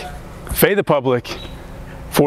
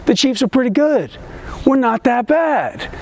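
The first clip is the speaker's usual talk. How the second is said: high-pitched, rising excitement; mock hopeful hype; 6 semitones higher than usual. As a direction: build false hope, climbing higher to the last phrase. Mock hopeful hype about the Colts.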